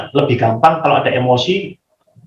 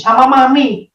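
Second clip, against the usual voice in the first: put-on high little child's answer; a small kid finally owning up; toddler voice; 11 semitones higher, 3 dB louder than usual. Two-year-old telling father it's mami, high childlike.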